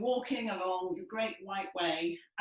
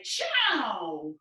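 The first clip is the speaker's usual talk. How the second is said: loud, higher calls of ciao; Italian greeting; 7 semitones higher, 8 dB louder, much slower than usual. Shown as a demonstration. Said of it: "And we might say".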